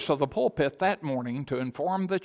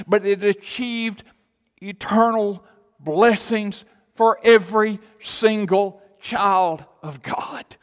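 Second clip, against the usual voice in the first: loud, high-pitched, driving sermon climax; full-force preaching; 7 semitones higher, 11 dB louder than usual. Real, not acted. Preacher proclaiming the glory of the cross to a church, full-force preaching. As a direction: build the proclamation, then stretch out the final phrases.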